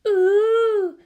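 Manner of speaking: high, delighted little ooh; a small girl's wonder; bright high ooh of awe; child's awed ooh. A narrator's reading. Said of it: "The child made an ooh sound".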